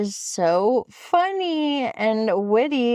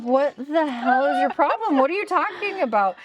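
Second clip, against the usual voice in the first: much higher, fast, fed-up disbelief; a retold indignant snap at the husband; exasperated outburst; 8 semitones higher, faster than usual. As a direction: throw it back at him, outraged. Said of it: "And I'm like".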